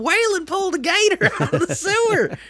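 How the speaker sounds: put-on very high, hollered kid voice; hammed-up backwoods child; excited kid calling Ma. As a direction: holler for your mother like a country kid. Playing kid calling to mother.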